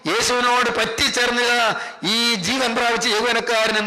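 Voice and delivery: ringing, chant-like sermon storytelling; fervent preaching. Real, not acted. Preacher telling how the funeral procession turned back in joy, fervent. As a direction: draw out each phrase with preacher's fervour.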